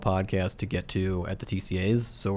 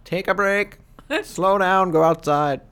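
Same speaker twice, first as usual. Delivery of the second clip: put-on cranky old man voice; 9 semitones higher, 8 dB louder, slower than usual. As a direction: scold like a grumpy old man. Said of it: "Old man Travers".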